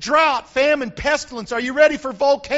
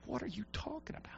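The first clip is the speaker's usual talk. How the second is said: breathless whispered question; hushed mock question; whispered skeptic; 4 semitones lower, 21 dB quieter, faster than usual. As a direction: whisper it like a doubting listener. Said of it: "You go".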